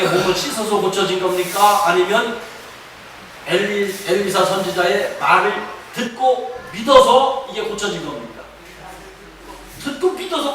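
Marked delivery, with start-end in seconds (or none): none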